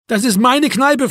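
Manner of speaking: high, loud protest; exasperated shout. A narrator's reading. Locked-out owner at his wits' end, exasperated shout. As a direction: shout it in frustration. Quarrel through locked door.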